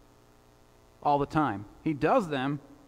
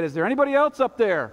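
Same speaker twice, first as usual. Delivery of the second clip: quick, higher, louder call upward; 5 semitones higher, 5 dB louder, faster than usual. Acted. Man on the cliff calls up for another helper, calling out. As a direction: call up hopefully for someone else.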